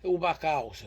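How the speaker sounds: "U back house" with a heavy Italian accent, dropped h. Shown as a demonstration. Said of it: "say in the back house with Italian accent".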